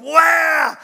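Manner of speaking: high loud mock crying wail; imitated toddler wail. Grandfather imitating grandson's wail, loud mock cry. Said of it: "So he's going".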